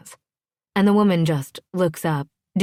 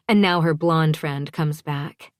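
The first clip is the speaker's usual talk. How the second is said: ordinary delivery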